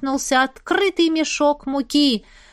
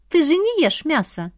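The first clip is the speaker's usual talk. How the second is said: teasing; 5 semitones higher than usual. Acted. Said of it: "подразнила я".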